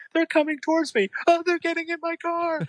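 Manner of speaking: high, hammed-up panic on the phone; imitating panicked Roy. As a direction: squeak it out like a terrified nerd.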